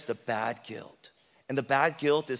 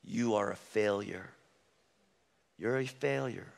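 soft, low voice that labels you a failure; quietly taunt, twice; quiet accusation; 5 semitones lower, 7 dB quieter than usual. Retold or imitated. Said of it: "he'll say".

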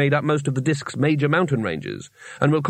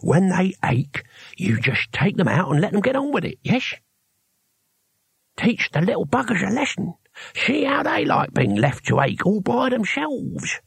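toothless awe; 6 semitones higher than usual. A narrator's reading. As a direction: relish it through bare gums. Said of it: "Cohen's very voice radiated awe".

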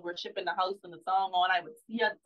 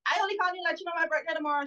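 much higher and fast, singsong show-off; mock announcer of birthday; 9 semitones higher, much faster than usual. Mother mimicking people who phone about their birthday, fast and high. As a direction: rattle it off like bragging about your birthday.

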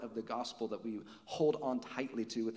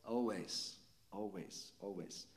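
each always lower and much softer; fading repetition; 6 semitones lower, 10 dB quieter, slower than usual. Shown as a demonstration. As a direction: let the word always fade away, like a hush. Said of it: "How often will he be with us?".

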